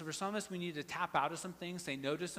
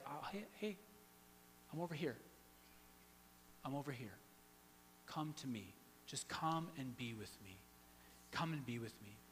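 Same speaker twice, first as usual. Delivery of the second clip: softer, gentle call back; soft Jesus voice; 3 semitones lower, 10 dB quieter than usual. Acted. Jesus softly calls the anxious believer to come to him. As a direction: coax them back quietly and tenderly.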